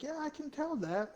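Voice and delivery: mocking Walmart lady. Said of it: "this lady's like".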